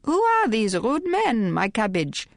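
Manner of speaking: put-on high, slow tipsy girlish voice; lightly voiced tipsy girl; giggly tipsy girl. A narrator's reading. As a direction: play a tipsy girl, drawn out. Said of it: "Sacha said in French".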